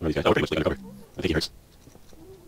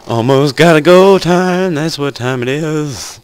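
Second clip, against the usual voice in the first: announcer voice; 10 semitones higher, 7 dB louder than usual. Pretend. Mock announcer catchphrase.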